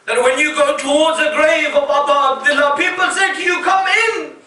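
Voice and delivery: fervent sermon climax rising high; rising preaching. Acted. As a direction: lift the voice as the passion builds.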